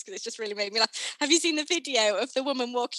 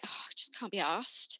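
weary; 8 dB quieter than usual. Retold or imitated. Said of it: "And I'm like".

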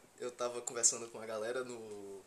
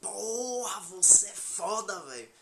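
admiring; 6 semitones higher, 5 dB louder, much slower than usual. Shown as a demonstration. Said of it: "Se você admira o cara, você fala".

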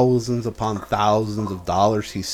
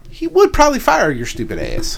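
scornful jab; 12 semitones higher, 4 dB louder than usual. Real, not acted. Addressing absent coworker angrily.

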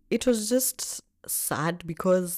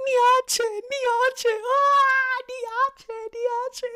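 high, loud repeated begging; reliving the plea to a friend who is hitting her; desperate pleading; 7 dB louder, much slower than usual. Retold or imitated. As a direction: cry out again and again to be left alone. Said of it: "Niache means leave me alone".

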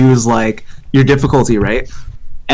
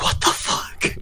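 whispered disbelief; 11 dB quieter, slower than usual. Retold or imitated. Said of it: "and I'm like".

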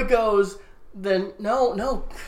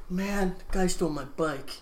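put-on dejected boy; 8 dB quieter than usual. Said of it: "he's like".

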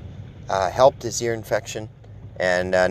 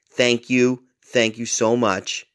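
ordinary talk, nothing marked

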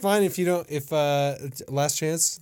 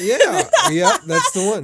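high loud laughing yeah; bubbly excited agreement; 10 dB louder, slower than usual. Real, not acted. Laughing reply to the show.

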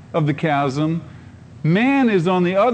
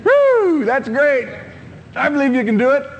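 cheering onlooker; 10 semitones higher than usual. Retold or imitated. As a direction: cheer from a safe distance. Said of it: "stand off and say".

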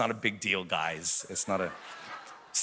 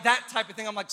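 ordinary talk, nothing marked

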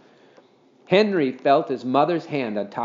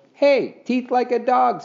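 high, loud exclamation; excited boy; 11 semitones higher, 4 dB louder, slower than usual. A narrator's reading. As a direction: pipe up, excited by a discovery. Boy delighted by his discovery.

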